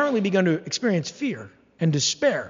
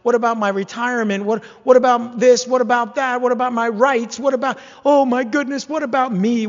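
louder, higher, fretful mock whine; mock self-centered whining; 4 semitones higher, 5 dB louder than usual. Preacher mocking a worrier fretting over money, loud mock whine. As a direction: whine like someone obsessed with their own stuff. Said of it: "you're so busy thinking about".

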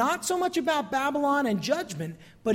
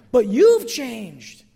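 loud, slow "you've changed"; slow emphatic; 8 dB louder, much slower than usual. Acted. Preacher pressing the congregation, loud and slow. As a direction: drive it home to the room.

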